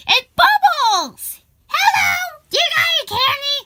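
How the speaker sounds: high-pitched, eager character voice; excited greeting. Acted. Greeting Bubbles, who asks for candy.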